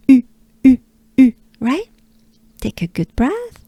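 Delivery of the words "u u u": U through nearly closed, rounded lips; higher, separate French U sounds; droopy French U. Shown as a demonstration. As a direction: drill U with a small mouth. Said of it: "not fully closed, but close".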